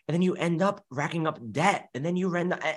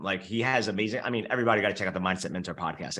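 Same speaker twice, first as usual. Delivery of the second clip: ordinary delivery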